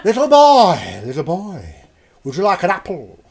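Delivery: hammed-up sultry call, laughing; mock sexy woman. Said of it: "That's a, that's a sexy woman".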